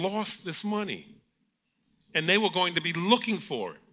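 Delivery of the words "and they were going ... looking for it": normal talking voice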